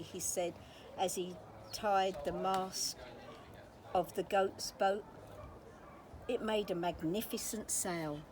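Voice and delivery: ordinary delivery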